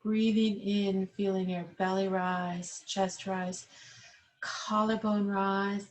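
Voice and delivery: calm, unhurried yoga instructor cueing the breath; guided breathing. Acted. Yoga teacher guiding seated class through belly breathing, calm guided voice.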